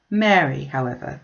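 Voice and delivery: plain reading voice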